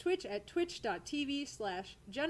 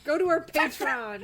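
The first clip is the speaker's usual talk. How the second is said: a lightly hammed Southern twang, a bit louder; Southern accent; 6 dB louder than usual. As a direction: fake Southern accent pitching the Patreon. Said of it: "You totally got Southern with it".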